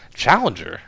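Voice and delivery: high, loud startled question; startled cry. A narrator's reading. Reporter startled at the professor's name, crying out. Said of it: "I cried".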